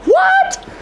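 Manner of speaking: very high-pitched, squeaky "what?"; incredulous squeal. Real, not acted. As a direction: squeak it out in sudden disbelief. Breaks off, incredulous squeal at outtake.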